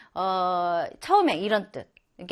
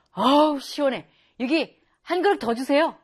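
loud, hearty relieved diner; satisfied diner; 4 semitones higher, 4 dB louder than usual. Acted. Teacher acting a diner pleased with hot soup, hearty. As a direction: call for another bowl after hot soup.

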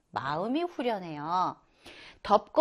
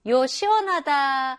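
normal talking voice

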